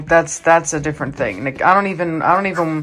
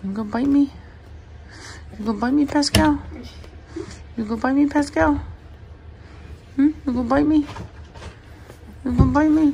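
higher playful pet voice; 9 semitones higher, 3 dB quieter, much faster than usual. Real, not acted. Owner teasing her nipping puppy, higher playful coo. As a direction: tease the puppy in a sing-song.

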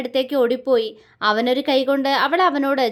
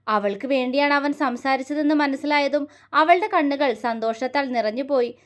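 usual speaking voice

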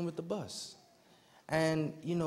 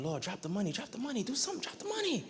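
pleading that climbs much higher each time; rising frantic prayer; 7 semitones higher, 6 dB quieter than usual. Retold or imitated. Retold frantic prayer before the seller.

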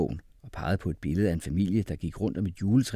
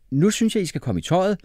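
high, loud, upbeat instructions; rally the children gladly; bouncy, happy father giving orders; cheerful; 9 semitones higher, 8 dB louder than usual. A narrator's reading. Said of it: "sagde han glad".

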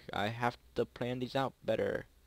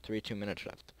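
ordinary delivery

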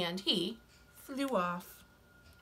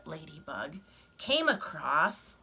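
plain reading voice

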